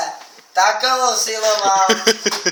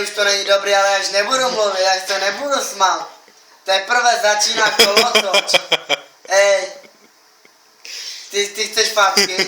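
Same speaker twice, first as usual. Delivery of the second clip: ordinary talk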